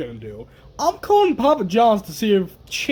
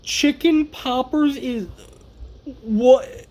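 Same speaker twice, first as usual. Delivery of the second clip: incredulous; 6 semitones higher than usual. Real, not acted. Disbelief at chicken poppers.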